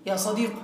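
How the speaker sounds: "صديق" ending in a clear u on the qaf, no final ya; ya sadiqu, dropped ya. Shown as a demonstration. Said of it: "حذفنا الياء وضممنا القاف".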